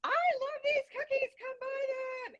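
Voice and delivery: put-on very high-pitched kid hawking cookies; little Girl Scout. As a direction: imitate a small cookie seller way up high. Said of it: "all the little Girl Scouts are out".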